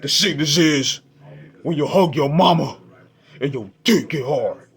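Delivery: demonic voice. Retold or imitated. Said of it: "in a demonic voice".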